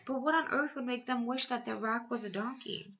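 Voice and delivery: ordinary delivery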